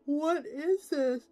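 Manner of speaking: slow disbelief. Real, not acted. Reading absurd rules.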